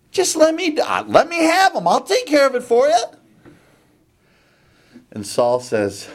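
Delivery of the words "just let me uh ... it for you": high, pleading offer; eager young David. Acted. Little David begging Saul for the fight, eager. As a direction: pitch it up high and push to be allowed.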